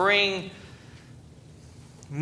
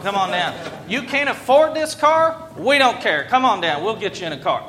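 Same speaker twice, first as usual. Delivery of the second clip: pushy car commercial; 3 semitones higher, 3 dB louder than usual. Retold or imitated. Pastor mimicking a used car commercial, loud hard sell. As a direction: hype it up like a cheesy car dealer ad. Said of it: "car companies will put out commercials".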